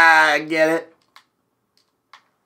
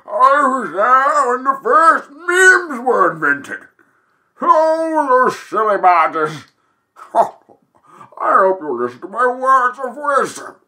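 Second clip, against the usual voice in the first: put-on old wise cloud; 8 semitones higher than usual. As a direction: speak like an elder of the skies. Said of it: "I'm Papa Cloud".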